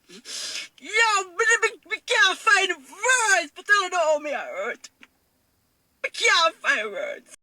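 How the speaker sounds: breaking into real sobs, voice cracking high; crying. Real, not acted. Mother telling of losing custody, sobbing openly. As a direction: she can't hold back the tears, slow and strained.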